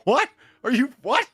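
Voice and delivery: high, loud American incredulity; mock disbelief. Shown as a demonstration. Demonstrating American disbelief for the others, high and loud. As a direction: burst out in baffled American shock.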